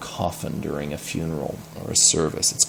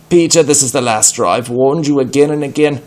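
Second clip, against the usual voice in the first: louder, stern telling-off; exasperated scolding; 6 semitones higher, 6 dB louder than usual. Acted. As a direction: scold him, fed up. Priest scolding Peter.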